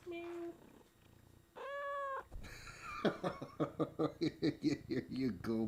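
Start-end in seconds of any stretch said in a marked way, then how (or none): 0.1-0.5 tiny high squeaky meow; cat meow. Pretend. Owner meows at her cats in a tiny high mew. 1.6-4.1 squeaky high giggle. Real, not acted. Owner giggles high while the cat kneads her.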